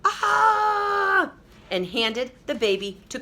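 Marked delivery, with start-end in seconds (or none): 0.0-1.3 high, loud pained shout; gorilla yell. Acted. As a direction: yelp like a bitten gorilla. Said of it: "the gorilla yelled".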